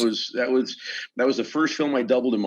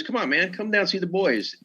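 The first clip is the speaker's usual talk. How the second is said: high, pleading friend's voice; mock-pleading buddy; coaxing buddy; 10 semitones higher than usual. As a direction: wheedle him to come out. Buddy coaxing in retold call.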